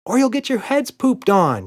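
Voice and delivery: high, loud gleeful yell; excited girl yell. A narrator's reading. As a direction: shout the threat joyfully. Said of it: "yelled Emma".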